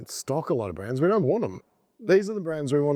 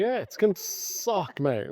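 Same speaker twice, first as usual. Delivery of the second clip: mock whine; 8 semitones higher, slower than usual. Mock complaint continues.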